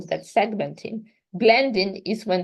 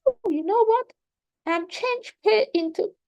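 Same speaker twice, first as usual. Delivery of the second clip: put-on high, bright demo voice for a child; teacher demonstrating to a student, high playful voice; 13 semitones higher than usual. Shown as a demonstration. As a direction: play the teacher setting a sound task, pitched well up. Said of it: "and then I say".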